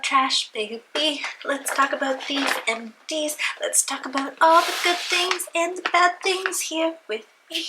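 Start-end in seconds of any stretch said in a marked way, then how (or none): none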